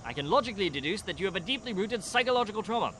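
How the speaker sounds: loud, lofty know-it-all; pompous detective. Acted. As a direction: lecture them like a smug genius. Detective character scene.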